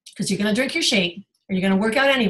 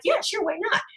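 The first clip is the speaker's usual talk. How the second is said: casual high reply; 10 semitones higher, 4 dB quieter than usual. Retold or imitated. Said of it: "I'm like".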